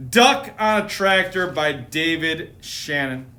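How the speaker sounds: usual speaking voice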